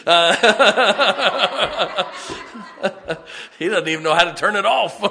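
loud laughing through the words; cracking up at his dad's phone going off; real laughter. Real, not acted. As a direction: laugh it out at the interruption. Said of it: "Oh, sorry. It was too good to waste".

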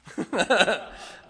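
loud burst of laughter; real high chuckling outburst. Real, not acted. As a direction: crack up at your own story. Laughs retelling the funny exchange.

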